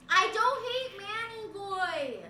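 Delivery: high, loud drawn-out tsundere protest; playful tsundere. Pretend. Streamer denying hate to chat, tsundere protest. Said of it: "I'm just being tsundere today".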